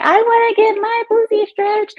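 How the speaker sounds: very high squealed quote, far off her own voice; mock rapper shriek. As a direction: shriek it like a crude stranger. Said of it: "when that woman screamed that".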